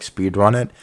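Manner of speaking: ordinary delivery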